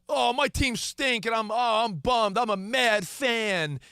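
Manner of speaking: high, drawn-out moaning of a fed-up fan; mock complaining. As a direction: imitate a sports fan griping, stretching the words out. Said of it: "when people bitch and complain".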